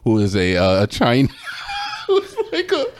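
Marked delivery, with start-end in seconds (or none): 1.7-2.8 high, giggling through the words; laughing. Real, not acted. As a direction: laugh while you say it.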